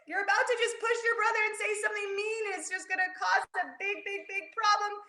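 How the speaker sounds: high fast breathless telling; teacher building tension before the pause; rushed tension. A narrator's reading. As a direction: race through the rising danger. Tense anger moment.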